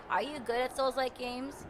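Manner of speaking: ordinary talk, nothing marked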